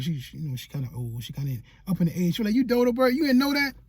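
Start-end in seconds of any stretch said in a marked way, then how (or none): 2.6-3.7 higher-pitched imitation of mom; imitated motherly exasperation; scolding mom. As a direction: act out mom's incredulous teasing rebuke. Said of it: "my mom's always said dodo bird".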